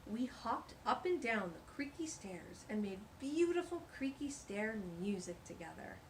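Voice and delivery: ordinary delivery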